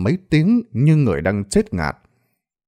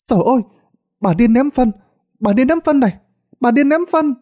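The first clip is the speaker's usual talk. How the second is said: high-pitched panicked cries; children yelling while running; 11 semitones higher, 3 dB louder, faster than usual. A narrator's reading. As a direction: cry out the alarm like a fleeing child. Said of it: "vừa chạy vừa hét lớn". (giọng trẻ con hét lớn, hoảng loạn)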